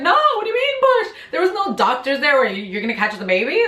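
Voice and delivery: high, fast disbelieving yelp; incredulous panic. Retold or imitated. Woman in labor protests to her mom, in high fast disbelief. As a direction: squeak out the panic at catching the baby yourself.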